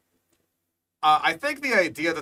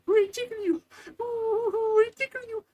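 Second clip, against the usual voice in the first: squeaky mock voice. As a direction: squeal it like a cartoon critter. Mocking the tickling dinosaur.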